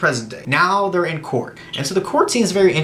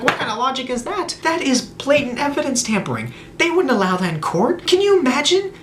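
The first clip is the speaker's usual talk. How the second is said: incredulous outrage; 9 semitones higher than usual. Acted. Reviewer reacting to absurd courtroom logic, incredulous outrage. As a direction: protest it like you can't believe the nonsense.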